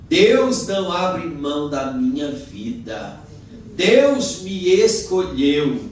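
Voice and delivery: emphatic declaration. Shown as a demonstration. Preacher showing believers how to claim it, proclaiming. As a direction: proclaim it with full conviction, stretching the words. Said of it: "bater no seu peito, meu filho, e dizer".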